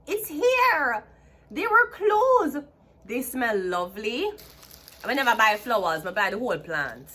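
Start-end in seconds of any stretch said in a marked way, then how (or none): 0.1-2.6 high, slow, thrilled squeal; squealing excitement. Real, not acted. To her viewers about her bloomed flowers, high delighted squeal. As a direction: gush over the flowers in delight.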